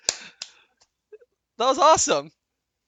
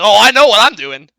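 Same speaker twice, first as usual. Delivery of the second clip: mock knowing bigot; 4 semitones higher, 12 dB louder, faster than usual.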